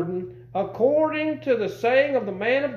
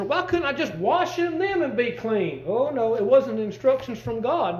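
ordinary delivery

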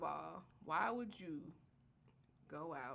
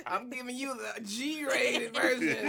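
laughing; 5 semitones higher, 6 dB louder, slower than usual. Real, not acted. Laughs while saying it.

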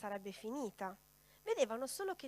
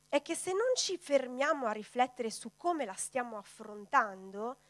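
ordinary talk

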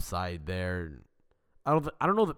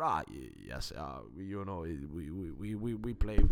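lower, halting, falsely genial Klopp voice; a light mock of a friendly press-conference manager; lovable Klopp impression; 6 semitones lower, 5 dB quieter than usual. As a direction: play the charming media face, stumbling sweetly. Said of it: "this, like, lovable character".

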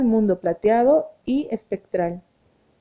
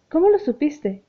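higher, sharp startled blurt; startled exclamation; 6 semitones higher than usual. A narrator's reading. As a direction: blurt it out, caught off guard. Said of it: "exclamó". (exclamación sobresaltada y aguda)

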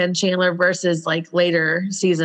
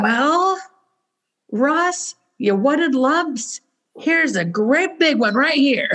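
high mock Monica voice; imitating Monica; 6 semitones higher, slower than usual. Monica snapping at Ross about lumpy potatoes, high mock voice. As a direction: Monica mocking Ross's lumps, pitched up. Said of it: "And she was like".

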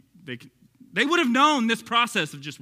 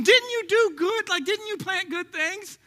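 high, slow, whining questions; whiny complaint; 13 semitones higher, 4 dB louder, slower than usual. Pretend. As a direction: plead like a spoiled child, slow. Mock complaint to God.

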